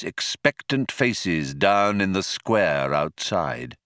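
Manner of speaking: usual speaking voice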